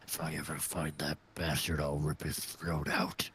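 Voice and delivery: low, growled threat; menacing orc. Acted. Orc vowing to kill a traitor, low menacing growl. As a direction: promise revenge in a deep snarl. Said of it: "He says".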